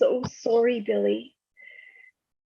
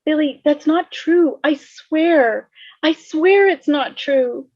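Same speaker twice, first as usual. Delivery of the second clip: louder and higher, slow and anguished; desperate pleading; 6 semitones higher, 7 dB louder, slower than usual. Acted. Max pleading with Billy, anguished. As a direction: beg him to believe you.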